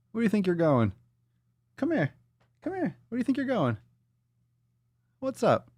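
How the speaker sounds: high, soft pet voice. Real, not acted. Talking to pet.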